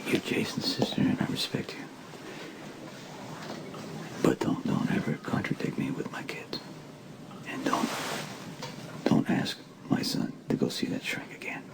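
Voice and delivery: angry whisper; father warning his sister-in-law in a tense whisper; hushed warning. Acted. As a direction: keep it low but firm.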